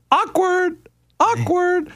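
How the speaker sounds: high, drawn-out awkward; sing-song awkward at the crowd. Retold or imitated. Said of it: "we were all just like".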